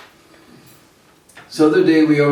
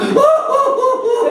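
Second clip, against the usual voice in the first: high slow hooting laugh; slow mock hooting laughter; mocking boyish laugh; 3 dB louder, much slower than usual. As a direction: stretch out a teasing hoot. Boys laughing at Krishna.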